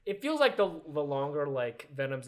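usual speaking voice

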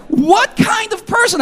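high exclamatory cry; exasperated high-pitched outburst; self-questioning outburst. Retold or imitated. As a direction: throw the question up high in disbelief. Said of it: "I was like".

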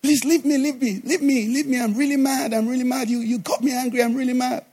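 high-pitched, repetitive mock fuming; imitated angry lady. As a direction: brush someone off in a high, agitated voice. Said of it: "So the lady said".

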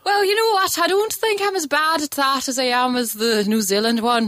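lilting Irish accent, starting high. Shown as a demonstration. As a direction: put on an Irish brogue. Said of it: "Now do an Irish accent".

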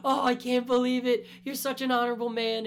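high, loud mock-weepy gushing; mock tearful. Podcaster imitating Frankie moved by Usopp's story, mock tearful. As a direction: wail it like an overcome, crying Frankie. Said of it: "he's crying".

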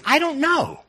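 high, exaggerated shrug of a voice; playful high I-don't-know; mock bewildered. Real, not acted. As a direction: toss it up high like a helpless shrug. Repeats the phrase, pitched up.

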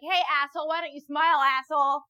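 loud, high jeering catcall; heckling a man from the bushes; mock catcall. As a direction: throw a catcaller's line back at him. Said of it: "pop out and be like".

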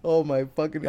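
ordinary talk, nothing marked

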